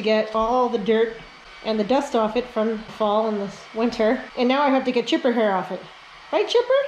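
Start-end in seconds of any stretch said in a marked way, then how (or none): 6.3-6.8 cooing at dog. Real, not acted. Calling to dog.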